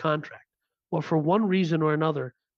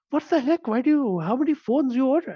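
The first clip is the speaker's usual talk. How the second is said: much higher, fast, exasperated dad voice; incredulous dad impression, pitch jumped high; imitating dad; 8 semitones higher, 3 dB louder than usual. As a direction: mimic the bewildered father, pitched way up. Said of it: "Don't make that voice".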